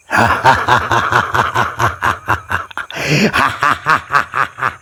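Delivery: long eerie evil laugh; sinister cackle. A narrator's reading. Sadhu's laugh startles Rahul, eerie cackle. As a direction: cackle like a menacing ascetic.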